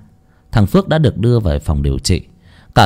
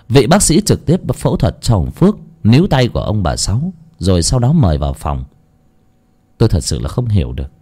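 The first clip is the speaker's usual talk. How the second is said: ordinary delivery, nothing marked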